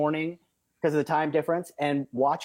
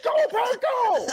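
very high, raised-voice team chant; high-pitched shout; 4 dB louder, slower than usual. Retold or imitated. Fans keep answering each other, high-pitched shout. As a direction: top the last cheer, pitched sky-high. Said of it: "And then he said".

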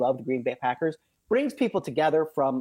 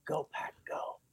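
whispered Go Pack, go; hushed, tentative team chant; very quiet; 12 dB quieter, slower than usual. Retold or imitated. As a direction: say it under your breath, barely audible. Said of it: "he very quietly said".

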